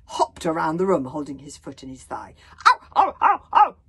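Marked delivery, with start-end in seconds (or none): 2.7-3.8 high, loud yelps of pain; crying out in pain. A narrator's reading. Bodyguard bitten on the thigh, loud yelps of pain. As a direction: hop about yelping at the bite. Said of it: "cried Humongous".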